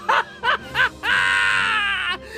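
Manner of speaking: long, high hysterical cackle; wild crazed laughing fit; maniacal laugh. Acted. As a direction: collapse into shrieking mad laughter. Laughing at absurdity of war.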